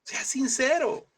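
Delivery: high, stretched-out preaching cry; emphatic exhortation. Real, not acted. Preacher repeating his plea to the congregation, high emphatic cry. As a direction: urge them, pitched high and very slow.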